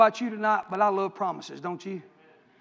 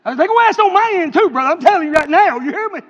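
sudden loud, raised declaration; emphatic outburst; 6 semitones higher, 9 dB louder than usual. Real, not acted. Preacher insisting suitors will ask him too, loud outburst. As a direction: burst out loudly, laying down the law. Mock-stern aside on his own children.

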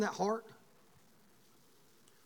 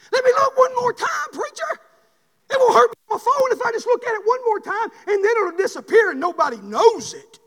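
higher, pleading voice; wheedling sinner; 12 semitones higher, 11 dB louder than usual. Acted. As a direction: beg the preacher for one more look. Sinner begging the preacher.

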